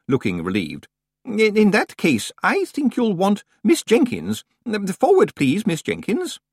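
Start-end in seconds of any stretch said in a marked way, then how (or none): 1.2-6.4 high, hesitant, distracted old librarian; Senior Librarian Swinsley. A narrator's reading. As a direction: mumble it while rummaging, fluttery and high.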